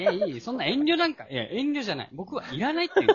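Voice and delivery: insistent refusal. Retold or imitated. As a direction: act out firmly turning down the offer. Acted refusal of offered candy. (必死に断る再現口調)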